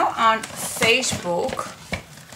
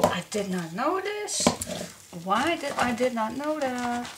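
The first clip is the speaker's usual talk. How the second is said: usual speaking voice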